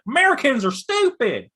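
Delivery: high, sneering heel mockery; mocking European heel. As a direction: sneer at the crowd like a cocky foreign wrestler. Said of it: "comes in and is like".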